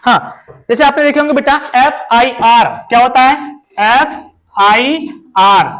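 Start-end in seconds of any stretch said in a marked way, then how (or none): 3.8-5.7 very slow, each letter stretched: MLA; slow spelling out. Shown as a demonstration. Teacher spells abbreviation for children, stretched slowly. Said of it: "drawn out for children".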